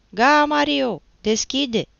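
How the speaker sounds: loud, high call through window. A narrator's reading. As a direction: holler to be let in. Nephew calls through the window. (strigăt de chemare, tare)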